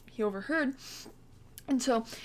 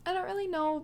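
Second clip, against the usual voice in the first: put-on hesitant girl; 8 semitones higher, faster than usual. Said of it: "And she's like".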